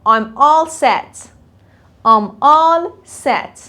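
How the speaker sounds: very slow, clear I'm all set; slow model. Shown as a demonstration. Teacher modelling for students, very slow. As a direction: stretch every word for learners. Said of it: "teacher models phrase slowly".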